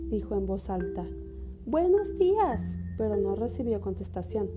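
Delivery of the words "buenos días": put-on little girl voice. Caperucita saluda con miedo.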